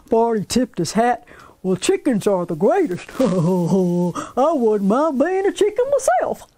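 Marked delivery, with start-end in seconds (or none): 1.6-6.5 hearty farmer with belly chuckles; jolly farmer. Acted. Farmer Barney greeting the girls, jolly and hearty. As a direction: boom it out like a folksy old farmer.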